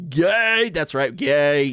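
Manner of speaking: loud, drawn-out mock cheer; playful exclamation. Real, not acted. Streamer teasing the chat for Pride, playful exclamation. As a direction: belt it out with playful gusto.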